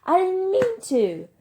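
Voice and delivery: high, drawn-out frustrated yell; loud, tearful-angry outburst at a very high pitch; voice cracking. Acted. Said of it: "My voice just cracked".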